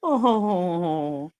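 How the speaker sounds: normal talking voice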